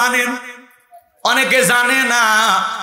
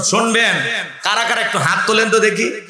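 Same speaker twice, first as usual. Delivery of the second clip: usual speaking voice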